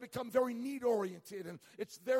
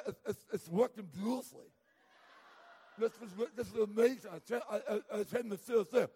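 numb mouth. Retold or imitated. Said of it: "cotton wads in my mouth".

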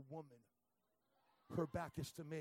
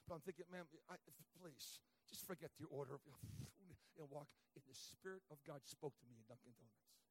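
usual speaking voice

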